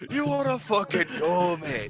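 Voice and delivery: loud high Cockney challenge; Cockney accent. Retold or imitated. Player answers the Lagoon in shouted Cockney. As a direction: shout it like a London brawler. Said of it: "Cockney accent".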